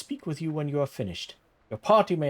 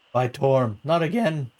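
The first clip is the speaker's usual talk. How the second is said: exasperated; 2 semitones higher, 7 dB louder than usual. Acted. Lady exasperated at suitor.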